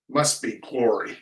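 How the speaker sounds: usual speaking voice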